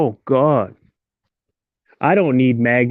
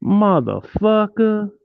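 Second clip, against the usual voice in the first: drawn-out curse; 8 semitones higher, slower than usual. Real, not acted. Frustrated that no one joined.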